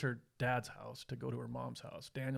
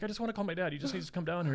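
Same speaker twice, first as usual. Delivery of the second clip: higher-pitched imitation of a girl begging; retold plea of a frightened girl to an officer; pleading girl; 6 semitones higher, faster than usual. Shaking girl begs officer for dad.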